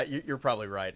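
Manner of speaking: normal talking voice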